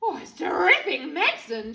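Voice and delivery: put-on loud, gleeful old woman; excited cry. A narrator's reading. Grandma delighted with the medicine, excited cry. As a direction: cry out with relish. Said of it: "she cried".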